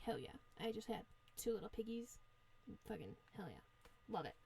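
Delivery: low, very soft self-congratulation; hushed, low gloating as the full-bellied wolf; smugly satisfied wolf. Acted. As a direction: say it to yourself, quietly pleased. Sated wolf walks away pleased.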